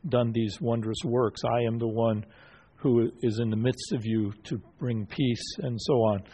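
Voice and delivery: ordinary talk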